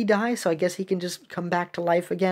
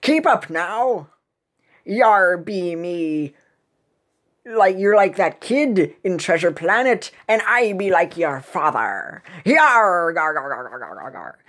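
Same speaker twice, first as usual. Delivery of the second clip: slow pirate brogue, trailing into gar-gar; hammed-up pirate chatter; blustering pirate captain; slower than usual. Pirate hurrying the shark along, blustering pirate voice.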